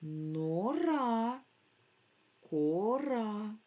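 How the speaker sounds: both syllables of «Нора» and «кора» drawn out. Shown as a demonstration. Teacher building words from syllables, drawn-out reading. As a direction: stretch each vowel as the syllables join. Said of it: "скласти слова з цих складів".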